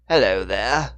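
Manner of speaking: loud, measured British greeting; deep British accent. A narrator's reading. British agent to a hidden figure in the dark, deep British accent. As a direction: call it out in Moore's British accent. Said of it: "said in his deep accent".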